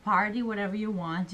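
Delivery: normal talking voice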